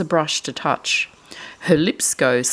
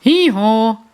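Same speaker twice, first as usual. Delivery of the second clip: loud high hee-haw bray; loud donkey hee-haw; 6 semitones higher, 7 dB louder than usual. Acted. Donkey noise in picture book.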